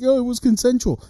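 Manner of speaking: high, quick mimicry; dismissive high-pitched imitation; mocking excuse. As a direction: toss it off as a silly excuse.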